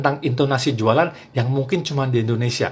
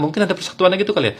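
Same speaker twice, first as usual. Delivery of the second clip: ordinary delivery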